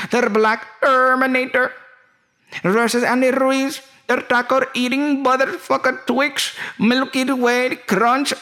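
very high, excited Middle Eastern caricature; Saudi friend. Acted. Saudi host boasts about bringing the fight, high accented caricature.

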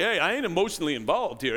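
lightly imitated low-voiced mob collector; mob leg breaker. Preacher quoting Rocky's collector, lightly voiced mob heavy. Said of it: "acted like a leg breaker for the mob".

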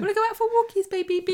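mock sing-song talk to a baby. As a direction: coo it like talking to a toddler. Cooing to a pretend baby.

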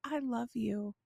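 soft, small retold child voice; sweet. Little sister suddenly to big sister on FaceTime, sweet. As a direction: say it quietly and sweetly, out of the blue. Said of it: "it was so sweet, out of the blue".

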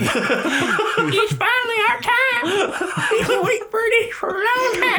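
mock squeaky triumph. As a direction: squeal it like a giddy local. Mock high voice, laughing.

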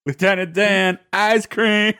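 high-pitched put-on Forrest Gump catchphrase; movie quote. Guest recalls Forrest Gump whenever having ice cream, imitated high. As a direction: lift it into a film-character impression. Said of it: "anytime I have ice cream".

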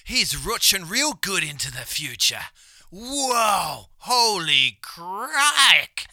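loud, high Aussie wildlife presenter; excited croc hunter exclamations; Steve Irwin impression. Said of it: "deine Steve Irwin-Imitation".

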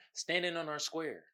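ordinary talk

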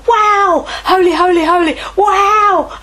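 loud, high cry of wonder, arms thrown wide; awed exclamation. Retold or imitated. Elders before the throne crying out in awe, loud and high.

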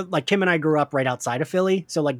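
ordinary talk, nothing marked

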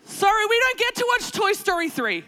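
high, loud mock scolding; exasperated parent. Mother refusing kids a film, loud and exasperated. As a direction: lay down the law, fed up.

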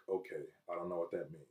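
low, very soft retold reply; meek acceptance. Man answering God as he blacks out, meek acceptance. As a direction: answer quietly, almost under your breath.

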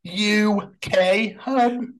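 high-pitched, put-on fussing; mock concern. Co-host parroting a hated phrase, mock concern.

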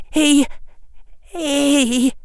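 put-on high, stammering scared boy; a crying child struggling to start; wavering boy's voice. A narrator's reading. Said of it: "the boy's wavering voice said".

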